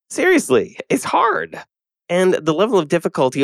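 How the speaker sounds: high, pleading emphasis; emphatic. Real, not acted. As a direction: stress how hard it really is. Emphatic aside, big pitch jump.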